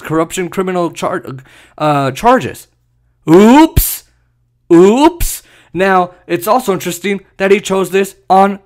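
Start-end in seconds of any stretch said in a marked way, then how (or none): 3.3-5.4 loud, high, rising mock innocence; sarcastic oops. Mocking Netanyahu's corruption charges, sarcastic oops. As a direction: sing out a fake-innocent slip.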